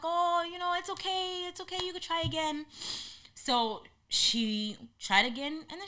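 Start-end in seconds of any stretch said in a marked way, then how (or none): 0.0-2.6 reassuring group. Retold or imitated. Group comforts scared girl.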